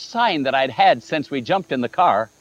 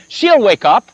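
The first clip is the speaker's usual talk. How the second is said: louder, higher ringing certainty; confident exclaim; 6 semitones higher, 7 dB louder than usual. Retold or imitated. To the father at the door, a louder, higher promise. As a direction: declare it as if already done.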